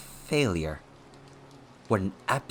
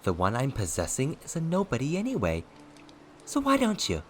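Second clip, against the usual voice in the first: high, swooping, mocking lilt; childish taunting; 7 semitones higher than usual. A narrator's reading. Possessing ghost daring his enemy to kill, childish taunting. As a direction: needle him like a bratty child. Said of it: "He taunted with childish noises".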